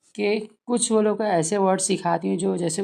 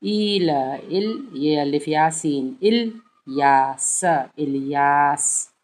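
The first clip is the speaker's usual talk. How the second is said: syllable-by-syllable reading; 3 dB louder, much slower than usual. Shown as a demonstration. Teacher sounds out Ilyas slowly for parents. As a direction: say इल, यास slowly then blend Ilyas. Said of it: "तोड़ तोड़ के".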